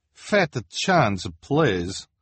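put-on high dismissive accented boss; Madame Lulu voice. A narrator's reading. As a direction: brush him off as the boss. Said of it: "Madame Lulu replied".

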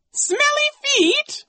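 very high squeal of disgust; squeamish woman. A narrator's reading. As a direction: recoil in shrill disbelief. Mrs. Bass recoils, wrinkling nose.

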